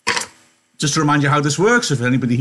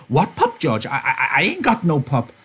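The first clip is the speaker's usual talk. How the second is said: Lenny lying; 3 semitones higher than usual. Acted. Lenny denies the pup.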